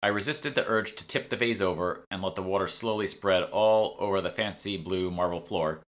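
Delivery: plain reading voice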